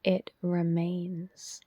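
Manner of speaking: measured, spacious delivery, leaving silence after each instruction; guided meditation. Real, not acted. Teacher guiding listeners into self-inquiry, guided meditation voice.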